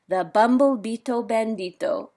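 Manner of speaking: ordinary delivery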